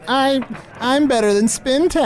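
put-on high petulant baby voice; petulant wizard baby. Mocking the wizard's baby dream, petulant. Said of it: "just a little petulant Usador baby saying".

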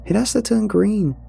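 put-on high, anxious little boy; a frightened kid holding his ground; child voice. Scared boy insists at crossing.

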